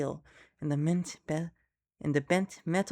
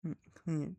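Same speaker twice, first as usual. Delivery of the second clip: ordinary delivery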